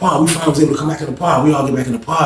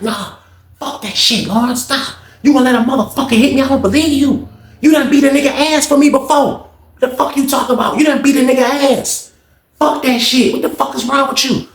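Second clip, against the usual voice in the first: pitched-up ranting BB; high mock rant; 10 semitones higher than usual. BB ranting that Lawrence won't fight for him, high voice. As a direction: rant fast in a high put-on voice.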